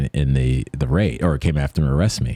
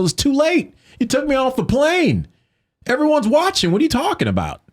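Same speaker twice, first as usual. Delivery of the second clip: much higher, exasperated retort at the agent; indignant outburst. Retold or imitated. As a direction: throw it back at him in outrage, pitched way up.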